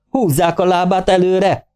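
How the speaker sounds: magas, elnyújtott vezényszó; kiáltás. A narrator's reading. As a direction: felolvasott parancskiáltás, mérsékelt hangerővel. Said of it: "Kiáltott a társam". (munka közben odakiáltott utasítás)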